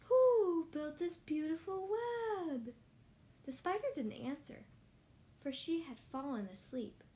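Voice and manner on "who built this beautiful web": slow, high, loud owl voice; storybook owl talk; hooting owl. Acted. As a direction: hoot the question, drawing out the words. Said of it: "asked the owl".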